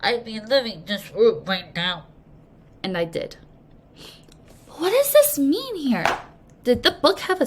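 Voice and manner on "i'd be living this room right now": stuffy nose. Acted. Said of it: "my nose suddenly and involuntarily closed".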